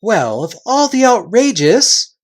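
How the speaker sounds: outraged. Acted. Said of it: "began Edmund".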